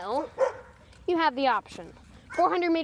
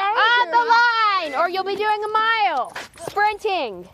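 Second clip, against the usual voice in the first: loud, high, slow command; calling out; 10 semitones higher, 6 dB louder, slower than usual. Real, not acted. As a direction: bellow it across the field. Coach calling runners to start.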